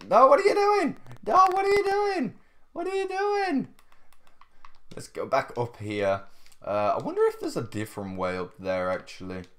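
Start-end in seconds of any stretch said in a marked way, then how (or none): 0.1-3.7 very high, louder squeal; squeaky mock panic. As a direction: squeal in playful alarm. Panicked teasing at player.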